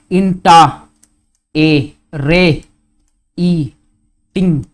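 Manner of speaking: "inta, A, re, E, ting" in separate slow pieces; sounding out syllables. Shown as a demonstration. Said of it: "How many vowel sounds".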